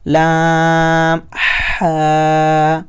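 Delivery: لام ہا drawn out very slowly; recited letters. Shown as a demonstration. Teacher reciting letters for students. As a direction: hold لام long, then ہا. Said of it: "یہ والا الفاظ پڑھیں گے".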